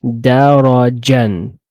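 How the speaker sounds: darajan drawn out slowly with a nasal final an; slow demo. Shown as a demonstration. Teacher slowly models darajan for the student. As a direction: sound out darajan slowly, ending in -an. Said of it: "so it's just".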